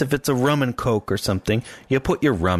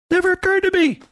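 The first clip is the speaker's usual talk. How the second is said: astonished realisation; 3 dB louder, faster than usual. Real, not acted. Sudden realisation, very high.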